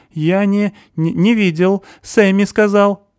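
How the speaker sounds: high, loud frightened stammering; cornered man stammering; panicky stammer. A narrator's reading. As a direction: stumble over the words in fear.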